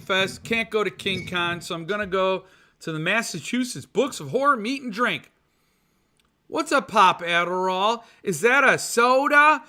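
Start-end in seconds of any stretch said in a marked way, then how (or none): 6.5-9.6 put-on a little kid asking, higher and louder; curious kid. Son Mitch waking up asking about Adderall, higher child voice. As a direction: play a small child puzzled by a word.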